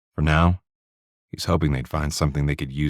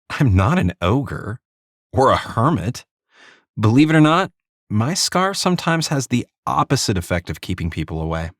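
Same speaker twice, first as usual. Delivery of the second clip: female detective voice; 8 semitones higher than usual. Acted. Narrator voices Di.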